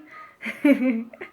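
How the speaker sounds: short laugh after thanking Lydie; real laugh. Real, not acted. Thanking Lydie on the live, then laughing. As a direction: let a quick laugh out.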